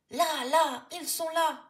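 put-on high, loud excited child's yell; boy yelling that he's found them; screamed. A narrator's reading. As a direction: scream it, pointing wildly. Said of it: "hurle Louis".

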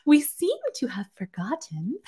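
high whispered saccharine tone; sickeningly sweet. Retold or imitated. Mocking the boss's sickly sweet memo voice. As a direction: breathe it out falsely sweet. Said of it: "super sickeningly sweet thing".

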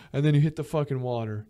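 usual speaking voice

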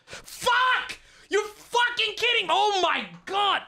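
real raging at the game; furious outburst. Real, not acted. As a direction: explode at the bad beat. Opponent has Karakas, outburst.

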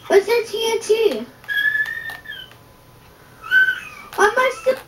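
high, put-on dismay; mock panic. Pretend alarm at explosives.

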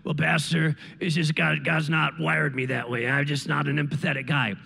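low, flat, half-joking man's excuse; a lightly voiced reluctant guy; low mock voice of a man dodging empathy; mock excuse-making man. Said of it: "some men in this place say".

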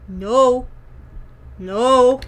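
usual speaking voice

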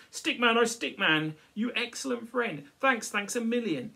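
high, loud, overjoyed; Santa's grateful thanks. Acted. Santa thanking Stickman for freeing him, high and loud. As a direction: gush with thanks.

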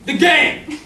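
excited exclamation. Acted. Death reminds of the game.

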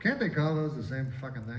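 ordinary talk, nothing marked

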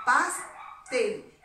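teacher marking the stressed syllable tel. Shown as a demonstration. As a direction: hit tel in pastel. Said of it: "y la mayor fuerza de voz".

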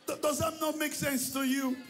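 high, loud shout; challenging shout. Real, not acted. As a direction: demand an answer from the crowd. Pastor challenging congregation.

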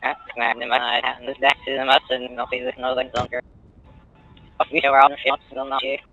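usual speaking voice

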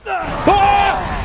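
high, sudden "ah"; startled yell. Real, not acted. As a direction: cry out at a sudden attack. Startled in game fight.

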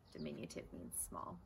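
softly whispered explanation; whispered aside. Real, not acted. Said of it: "reader's aside explaining word".

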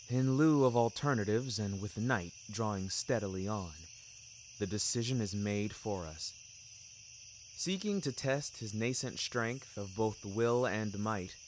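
usual speaking voice

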